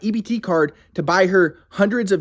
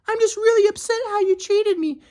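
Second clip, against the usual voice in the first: high falsetto complaint; mocking his ex. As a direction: read her text in a high whiny voice. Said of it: "she texts me".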